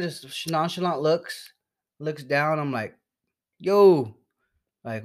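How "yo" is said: loud, raised call for attention; retold calling out. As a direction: holler it to someone behind a closed door. Calls to clerk through locked door.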